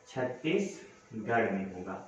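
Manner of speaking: low, soft and slow emphasis; slow, soft repeat of the answer; slow quiet repeat. Shown as a demonstration. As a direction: say it again slowly so it sticks. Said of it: "sorry, United कह रहा हूँ".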